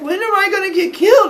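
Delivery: high, fast, loud wail; rapid falsetto panic; mock terrified child. Boy pestering the policemen, fast high panicked squeal.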